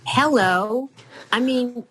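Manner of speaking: ordinary delivery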